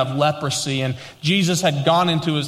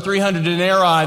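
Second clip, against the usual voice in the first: ordinary talk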